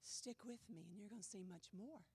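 quiet confiding promise, lightly voiced; leaning in, hushed. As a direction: lean close and say it low. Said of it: "leaning in and going".

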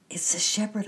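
high, loud distressed outburst; upset child near tears; sobbed confession. A narrator's reading. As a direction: blurt it out tearfully. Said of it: "I sobbed".

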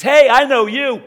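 demonized man calls out to Jesus, loud and high; demon voice. Acted. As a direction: shriek like the possessed man. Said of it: "this demonized guy speaks up. He says".

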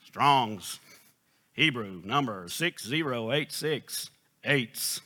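lightly mimicked recorded readout, measured pace; a hint of a device announcing a dictionary entry; imitated audio pronunciation guide. Preacher imitates the concordance audio a second time, lightly mimicked recording. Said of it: "so I'm hitting the volume button".

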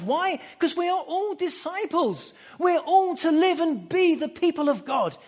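high-pitched, driving preacher's voice; fervent sermon climax, pitched high; impassioned preaching. Real, not acted. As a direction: preach it with rising fervour. Preaches with rising intensity.

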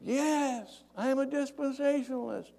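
high-pitched mock call from above; thin acted call back; yelling down. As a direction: imitate a distant holler without much volume. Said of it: "the guy yells down".

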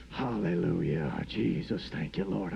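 low, slow, eerie "Hallelujah"; a man scaring himself with his own moan; spooky praise. Retold or imitated. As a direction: drag it out deep and ghostly. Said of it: "I'm scaring myself right now".

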